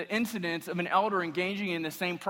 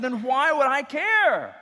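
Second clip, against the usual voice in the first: incredulous retort; 10 semitones higher, 8 dB louder, slower than usual. Retold or imitated. Pastor's retold retort, drawn out.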